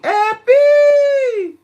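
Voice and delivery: high, loud wailing call of the name; movie-style crying call. Shown as a demonstration. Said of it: "That's how I say your name".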